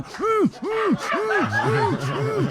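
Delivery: high, slow muffled pleading; gagged man's muffled cries. Retold or imitated. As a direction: whimper through a gag. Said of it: "with a guy going".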